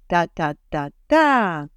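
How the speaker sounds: "ta-ta-ta-ta" slow, the beat carried to the end; Hebrew stress rhythm. Shown as a demonstration. Teacher tapping out Hebrew rhythm, slow and even to the end. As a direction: count out "ta-ta-ta-ta" slowly, ending strong. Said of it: "it goes like this".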